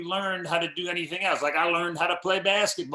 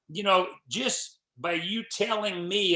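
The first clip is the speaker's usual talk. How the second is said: normal talking voice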